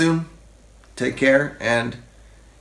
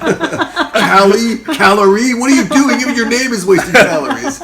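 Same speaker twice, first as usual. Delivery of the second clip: high, loud, laughing scolding; laughing high mock scold; 11 semitones higher, 10 dB louder than usual. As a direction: scold her through laughter. Husband voiced, laughing, high.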